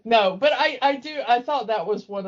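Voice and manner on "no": usual speaking voice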